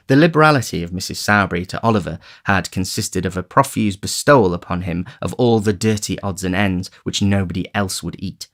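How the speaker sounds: ordinary delivery, nothing marked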